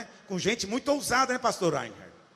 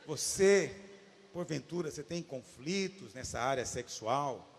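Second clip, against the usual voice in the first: low, slow, halting question; slowly, carefully; 7 semitones lower, 5 dB quieter than usual. Retold or imitated. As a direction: pick each phrase gingerly, with pauses. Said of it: "mas vou falar devagarzinho".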